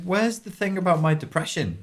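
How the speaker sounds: confused seeker wanting a straight answer; baffled complaint. Retold or imitated. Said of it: "he's just sat there going".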